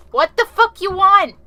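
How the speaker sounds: high, loud yell; shouted challenge. Real, not acted. Player yelling at a knocking door, shouted challenge. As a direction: yell back at whoever is knocking.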